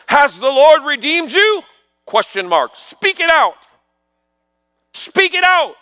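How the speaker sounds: high, emphatic pulpit preaching; fervent preaching. Acted. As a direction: build to a ringing proclamation with punched phrases. Preaches from scripture.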